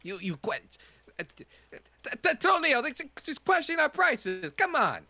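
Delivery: high, loud, outraged haggler; pushy shopkeeper. Acted. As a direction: defend the steep price, loudly. Shopkeeper NPC voice.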